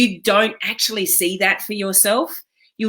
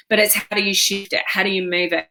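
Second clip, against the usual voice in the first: ordinary talk, nothing marked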